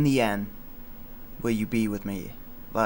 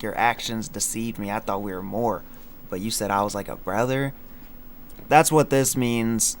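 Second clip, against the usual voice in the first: ordinary delivery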